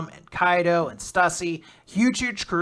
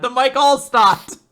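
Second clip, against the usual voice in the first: louder, higher hammed-up sportscaster; mock football announcer; 9 semitones higher, 11 dB louder, slower than usual. Joking with Nick about Buccaneers players, hammed-up. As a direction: ham it up like a football commentator.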